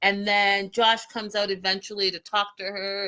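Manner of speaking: ordinary delivery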